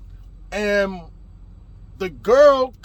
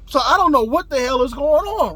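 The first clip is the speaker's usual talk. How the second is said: normal talking voice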